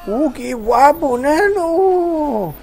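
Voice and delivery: high, loud, slow amazed cry; excited shouting. Real, not acted. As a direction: yell out in pure wonder. Thrilled by the flight.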